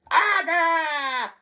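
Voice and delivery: high, drawn-out triumphant screech; piercing harridan's cry; shriek. A narrator's reading. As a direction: shriek it in gloating delight. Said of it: "shrieked Missus Pratchett".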